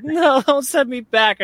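high, slow, laughing whine; whiny plea. Retold or imitated. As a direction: beg not to go back, whining. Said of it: "And I was like".

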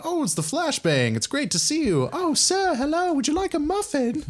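high, fawning farm parent voice; gushing parents. Acted. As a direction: fuss over the hero. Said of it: "the mom and dad come downstairs, like".

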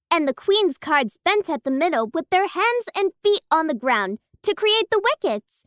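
ordinary talk, nothing marked

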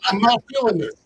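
high, loud refusal; indignant protest. Retold or imitated. Teenager rejecting his new nickname, loud indignant protest.

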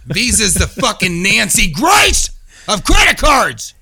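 ranting shout. Acted. Mock rant against Visa.

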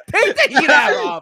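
high, loud joking command; mock demand. Real, not acted. Host yelling at guest to take off the hat, playful shout. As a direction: bark it at the guest in jest.

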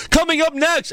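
high-pitched hyped promo voice; recap announcer. Retold or imitated. Podcaster mimics edited recap teaser, hyped announcer. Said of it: "so it's like".

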